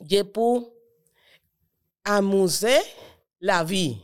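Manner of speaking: ordinary talk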